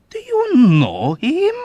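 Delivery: high, slow, loud delight; old man delighted by the coincidence. A narrator's reading. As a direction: ask it with gleeful surprise. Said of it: "inquired the old man with delight".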